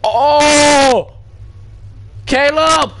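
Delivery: startled shout for help; scream. Real, not acted. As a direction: yell out in sudden fright. Jump scare, calling out.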